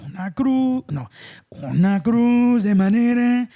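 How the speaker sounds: slow, loud raspy singer's voice. Retold or imitated. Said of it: "I can do that [name] voice".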